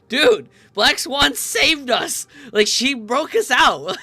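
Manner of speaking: loud, high, stretched-out protest; excited outburst. Real, not acted. To the game characters, shouting in outraged excitement. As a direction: shout it like the fan defending her.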